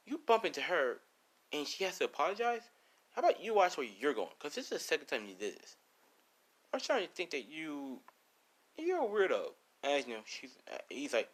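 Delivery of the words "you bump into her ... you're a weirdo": put-on high-pitched indignant girl; acted indignation, pitched well up; Ashley. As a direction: scold him in a raised, girlish pitch. Ashley scolds man who bumped Lisa.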